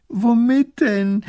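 slow, high, loud wail; sobbing. A narrator's reading. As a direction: wail it tearfully. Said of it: "fängt wieder an zu schluchzen". (langgezogen schluchzend)

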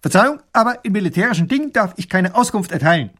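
höher, schnell und zackig gemeldet; militärisch stramm heruntergerattert; schnarrender Soldat. Acted. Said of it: "schlägt die Hacken zusammen und schnarrt". (Soldat schnarrt zackig die Meldung)

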